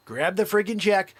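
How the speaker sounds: ordinary talk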